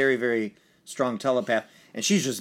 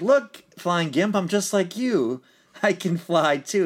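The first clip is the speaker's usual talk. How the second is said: put-on higher, louder, slow kid voice; little kid voice; 7 semitones higher, 4 dB louder, slower than usual. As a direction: play an eager little kid boasting he can fly. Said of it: "the little kid's like".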